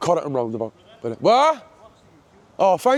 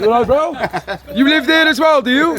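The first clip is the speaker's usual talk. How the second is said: high, raised call to a neighbour; 10 semitones higher, 4 dB louder than usual. Real, not acted. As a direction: holler up to someone at a distance, pitched high. Calling up to another resident.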